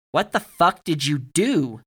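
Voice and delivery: loud, drawn-out outraged question; indignant. A narrator's reading. Barry confronts Alex after boxes nearly hit him, indignant. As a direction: demand it in slow, high indignation. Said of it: "Barry's indignation was palpable".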